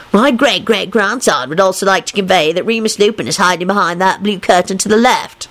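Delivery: mocking put-on relay of a message. A narrator's reading. Ancestor giving away Lupin's hiding place, mocking. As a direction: relay it with mockery. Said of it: "he added in a mocking voice".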